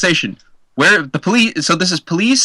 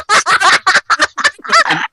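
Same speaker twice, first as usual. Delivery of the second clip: awkward laugh. Retold or imitated. Retold man laughing awkwardly.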